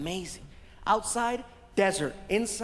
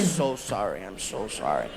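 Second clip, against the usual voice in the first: deep, contrite delivery; low apology; 13 semitones lower than usual. Retold or imitated. Apology after the name mix-up, deep and contrite. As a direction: sink very low as you apologise.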